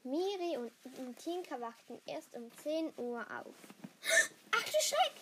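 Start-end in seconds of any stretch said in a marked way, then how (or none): 3.6-4.3 startled gasp. Acted. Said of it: "rief Miri". 4.5-5.1 high, loud cry of alarm; a shocked yelp of dismay; startled cry. Acted. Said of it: "rief Miri".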